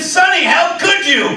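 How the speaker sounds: hammed-up disgust at the dog; mock outrage. Dog farts under the table.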